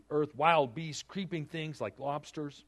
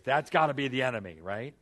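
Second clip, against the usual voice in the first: ordinary talk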